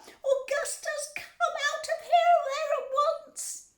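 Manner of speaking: high, drawn-out screeched command; yelling. A narrator's reading. Mother ordering stuck son out of pipe, yelling. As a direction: screech slowly and loudly at your child. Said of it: "yelled Missus Gloop".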